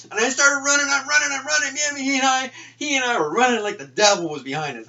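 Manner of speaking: high, loud excited telling. Real, not acted. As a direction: relive the frantic run. Excited retelling of the escape.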